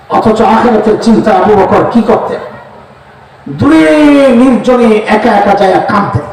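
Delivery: ordinary delivery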